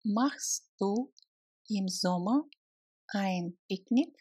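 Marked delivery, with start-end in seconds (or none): none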